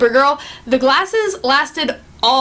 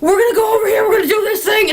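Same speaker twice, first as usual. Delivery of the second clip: hyped quote; 8 semitones higher, faster than usual. Retold or imitated. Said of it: "he's just like".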